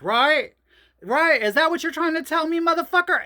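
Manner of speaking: building from sneering to loud, high yelling; retold angry rant. To husband Mickey in the courtyard, escalating to angry yelling. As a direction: work up to a furious shout. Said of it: "I said".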